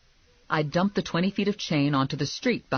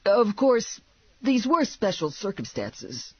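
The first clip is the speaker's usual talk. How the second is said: high-pitched, placating character voice; hasty backtracking; 7 semitones higher than usual. A narrator's reading. Sidekick to glaring bounty hunter, placating. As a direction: backpedal after getting a dirty look. Backtracking after partner's angry look.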